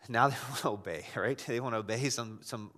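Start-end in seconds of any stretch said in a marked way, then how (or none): none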